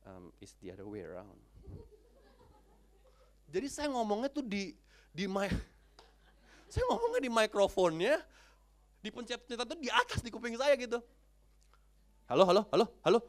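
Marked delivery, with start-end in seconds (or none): 0.1-1.4 low, soft voice of the CEO; understated correction. Retold or imitated. CEO points out phone is reversed. 12.2-13.2 very loud, rapid-fire hellos; insistent phone hello. Retold or imitated. Calling louder into upside-down phone.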